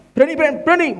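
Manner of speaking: high, eager cheering. Retold or imitated. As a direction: pump the kids up, pitched high. Encouraging the boys.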